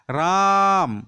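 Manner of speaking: राम with the aa held very long and loud; prolonged vowel. Shown as a demonstration. Teacher demonstrating pluta swar to students, राम hugely drawn out. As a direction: stretch the vowel in राम out like a sustained call. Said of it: "जैसे आवाज़ निकाल रहे हैं".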